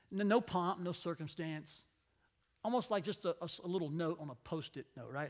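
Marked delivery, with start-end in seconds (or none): none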